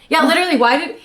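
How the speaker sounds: usual speaking voice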